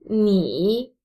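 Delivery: 你 slow, dipping low; third tone. Shown as a demonstration. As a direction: draw out "你" and sink the pitch. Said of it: "is a third tone".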